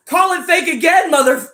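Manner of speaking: loud, high mock threat; mock angry shout. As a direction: bark it like a fed-up skeptic. Acted threat to commenters.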